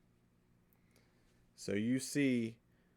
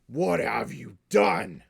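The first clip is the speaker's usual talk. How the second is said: loud, raised, outraged gruff orc; gruff Southern orc; 5 semitones higher, 8 dB louder than usual. Acted. GM as orc confronting the party, gruff Southern outrage. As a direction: bellow it in a rough Southern growl. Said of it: "it's, it's a southern accent".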